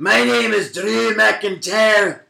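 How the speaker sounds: high, slow, hammed-up Scottish accent; Drew McIntyre. Co-host defends his wrestler impression, exaggerated Scottish accent. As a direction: announce yourself in a stretched mock Scottish brogue. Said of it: "that sounds like Drew McIntyre".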